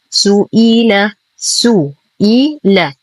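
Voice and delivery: سئيل slowly, full u on سو and full i on إي; full short vowels. Shown as a demonstration. Teacher breaks the word up for learners, full vowels in سئيل. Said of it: "نتم ضمة السين كسرة الهمزة".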